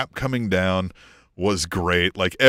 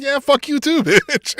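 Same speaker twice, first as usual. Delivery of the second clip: high, louder playground-style insult, laughing at the end; mock angry comeback; 14 semitones higher, 3 dB louder than usual. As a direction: throw it back, cracking up on the last word. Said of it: "And they're like".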